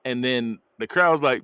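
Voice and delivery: ordinary talk, nothing marked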